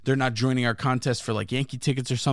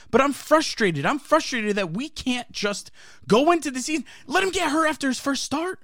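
frustrated fan; 10 semitones higher than usual. Real, not acted. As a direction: vent like a fed-up fan. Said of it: "I'm fucking frustrated".

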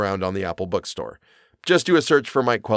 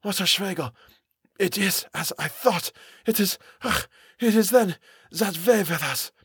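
put-on high, halting, accented character voice; dazed, faltering high voice; mumbling foreigner; 7 semitones higher than usual. A narrator's reading. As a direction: murmur brokenly in dread, pitched up. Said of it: "Kurtz mumbled".

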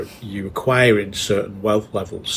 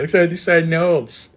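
slow, higher northern accent; 8 semitones higher than usual. Shown as a demonstration. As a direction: say it slowly in a broad northern accent. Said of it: "Particularly in a northern accent".

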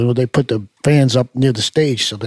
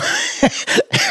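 breathy laugh; 5 dB quieter than usual. Real, not acted. Laughing at memory of Jerseyites.

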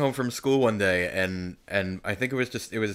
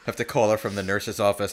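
ordinary talk, nothing marked

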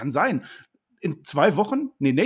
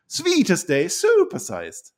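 drawn-out announcer voice; 4 semitones higher, much slower than usual. Pretend. Hyping catchphrase again.

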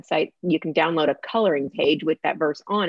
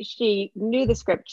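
ordinary delivery

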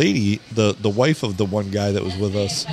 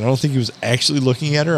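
ordinary delivery

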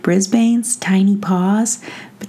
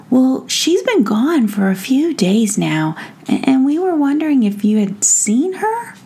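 lightly voiced gnome, pitched up; higher gnome voice, tentative question at the end; concerned gnome; 6 semitones higher than usual. Tom Gnome asks after missing fairy, lightly voiced concern.

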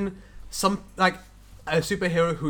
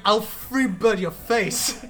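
mock threat; 4 semitones higher, much slower than usual. Guitarist voiced threatening.

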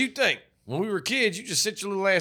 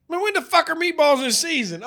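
higher, louder disbelieving demand; incredulous outburst; 12 semitones higher, 5 dB louder than usual. Retold or imitated. Retold outburst at the sub shop counter, incredulous. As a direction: blurt it out in total disbelief.

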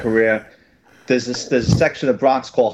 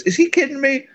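much higher, disbelieving; incredulous partner; 12 semitones higher than usual. Retold or imitated. Partner reacts to the rude attorney, incredulous and high. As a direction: ask it as if you can't believe it.

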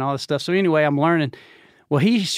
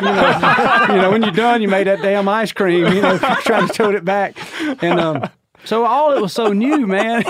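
laughing, louder and higher; 5 semitones higher, 5 dB louder than usual. Real, not acted. Remembering Golden Corral ice cream, laughing loudly. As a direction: tell it through laughter.

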